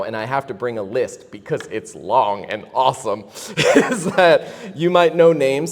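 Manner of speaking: laughing through the words, slowed down; can't stop laughing mid-sentence; laughing while talking. Real, not acted. Excited laughing while listing.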